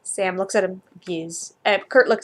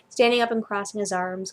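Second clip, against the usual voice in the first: ordinary delivery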